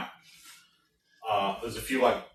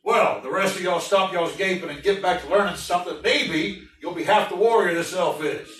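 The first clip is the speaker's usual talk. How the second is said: louder, higher barking; sergeant; 6 semitones higher, 4 dB louder than usual. Acted. Sergeant scolding the watching recruits, louder. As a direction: scold the gaping crowd.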